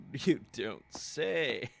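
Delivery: slow, loud dry sarcasm; sarcastic. Real, not acted. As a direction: drag it out mockingly. Sarcastic reply to game text.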